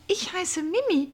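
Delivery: a bright little doe introducing herself; Mimi's voice. A narrator's reading. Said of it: "sagte die Häsin".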